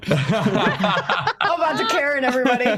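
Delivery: laughing through the words, slowed; getting it out between laughs at the table. Real, not acted. Player cracks a Karen joke at the table, laughing.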